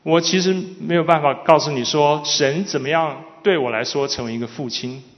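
usual speaking voice

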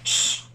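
soft, voiceless "shh"; quiet hushing sound; hushing shush. A narrator's reading. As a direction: shush a child into silence. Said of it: "立刻叫小史丹安静".

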